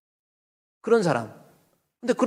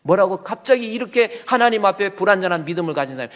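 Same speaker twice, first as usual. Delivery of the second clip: normal talking voice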